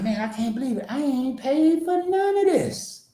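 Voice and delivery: high, slow marveling; amazed self-quote. Retold or imitated. Telling viewers how he reacted to free purchases, high and slow in disbelief. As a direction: stretch it out in disbelief. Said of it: "So I was like".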